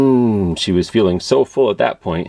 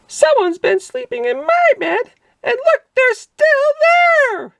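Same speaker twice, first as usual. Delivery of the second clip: very high, slow, excited cub voice; loud baby bear; 4 dB louder, slower than usual. A narrator's reading. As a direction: squeal it like a thrilled tiny cub. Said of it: "talked so loud".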